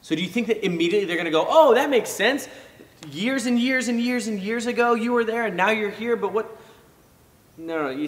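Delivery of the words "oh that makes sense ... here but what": high, loud, mock-cheerful Pharisee; mock naive acceptance. Preacher mocking Pharisees who would simply agree, high and loud. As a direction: play the gullible listener, way up high.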